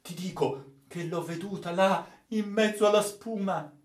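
high insistence, climbing in pitch; obsessed, urgent assertion; feverish energy. Acted. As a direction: insist as if possessed, pitch rising. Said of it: "riprese con sovrumana energia".

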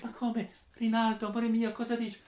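lightly voiced hurt young woman; hurt surprise. Angelica bewildered by rejection.

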